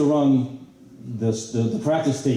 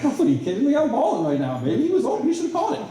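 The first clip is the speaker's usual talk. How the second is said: high, incredulous retort; mock indignant. Quarterback answering Ricky's taunt, high and incredulous. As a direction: squeak back in mock outrage. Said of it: "I'm like".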